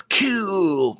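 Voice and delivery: long drawn-out, mocking "cool". Host reading a viewer's praise of blowing up Vulcan, mockingly. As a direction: stretch the word out in mock enthusiasm.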